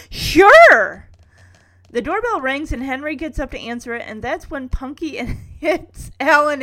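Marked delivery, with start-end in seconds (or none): 0.1-1.1 high, very loud burst; hammed-up enthusiastic shout; jumping in eagerly. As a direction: cut in with over-eager agreement. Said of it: "Alan jumps in saying".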